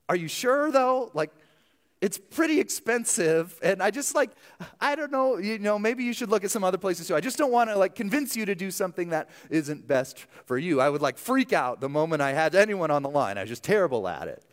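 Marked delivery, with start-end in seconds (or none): 0.1-10.8 much higher, anxious, hesitant voice; a pitched-up nervous self talking a student out of it; nervous backpedaling. Retold or imitated. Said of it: "something in me switched and I'd be like".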